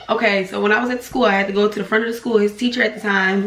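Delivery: usual speaking voice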